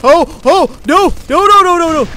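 loud, high, rapid-fire alarm; panicked outburst. Real, not acted. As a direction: cry out as danger suddenly hits. Sudden danger in the game.

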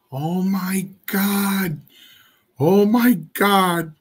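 high, slow, drawn-out gasps of worry; a frightened mother crying out, drawn long; panicked mother. Retold or imitated.

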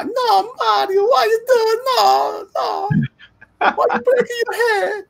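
very high-pitched mock Italian wailing; fake Italian cartoon voice. Shown as a demonstration. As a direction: squeal in distress like a cartoon plumber. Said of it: "and this is the voice".

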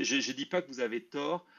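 ordinary delivery

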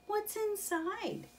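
curious Mole. Acted. Mole eyes the picnic basket, high slow curiosity. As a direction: ask with childlike curiosity. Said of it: "Mole asked".

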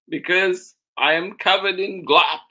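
put-on high, loud child's complaint; indignant child. Charlie complains about glop.

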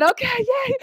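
high, light little cheer; girlish cheer at the good news; giddy yay. Retold or imitated. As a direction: squeak it out, thrilled. Hearing he'll make preparations.

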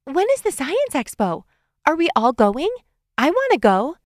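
put-on high-pitched child's voice; high, excited kid wanting in; eager little girl piping up. A narrator's reading. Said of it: "Afton pipes up".

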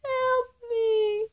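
high, soft wail for help; thin, drawn-out pretend cry for help; feeble plea. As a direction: plead weakly in a high little voice. Acted plea after scream.

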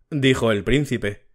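usual speaking voice

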